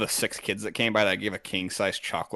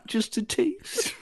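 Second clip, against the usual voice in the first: high, slow, giggling confession; laughing; 9 semitones higher, 6 dB louder, much slower than usual. Acted. Admitting to friends he enjoyed it, giggling high. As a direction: squeak it out through laughter. Joking about enjoying sewage.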